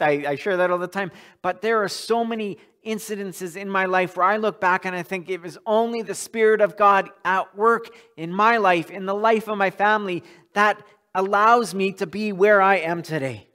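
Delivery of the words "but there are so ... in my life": ordinary talk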